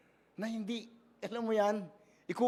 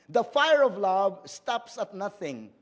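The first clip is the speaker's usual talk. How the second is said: The fire of love stops at nothing, slowly and louder; a weighty pulpit reading; slow emphatic scripture reading; 5 dB louder, slower than usual. Shown as a demonstration. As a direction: proclaim the verse, leaning on fire. Preacher reading scripture aloud.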